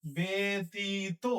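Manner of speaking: ব্যতীত drawn out as be-ti-to; slow model pronunciation. Shown as a demonstration. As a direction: stretch each syllable of ব্যতীত, ending in o. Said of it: "এটা আমরা কি বলবো".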